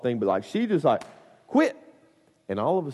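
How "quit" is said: sudden high, sharp scold; scolding mom. Retold or imitated. Mom to kid grabbing things at checkout, high scolding snap. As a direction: imitate a mom's curt, high-pitched order.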